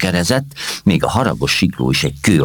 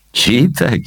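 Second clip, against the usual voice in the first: fond reproach; 8 semitones higher than usual. Acted. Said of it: "csóválta a fejét Kázmér".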